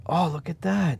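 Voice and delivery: high-pitched exclamation of discovery; pleasantly surprised. Real, not acted. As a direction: exclaim as if caught by a real surprise. Button press has a visible effect.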